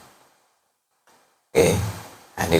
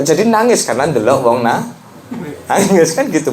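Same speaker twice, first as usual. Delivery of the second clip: higher, teasing back-and-forth; amused mock dialogue; 6 semitones higher than usual. Preacher acting out a puzzled chat, higher and teasing. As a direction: act out a puzzled chat about crying. Acted dialogue about crying.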